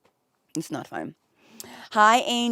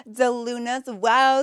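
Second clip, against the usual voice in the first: ordinary talk, nothing marked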